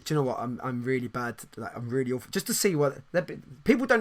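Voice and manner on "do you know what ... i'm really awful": mock glum answer. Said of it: "one time I'm gonna go".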